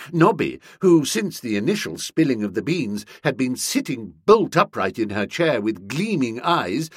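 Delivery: plain reading voice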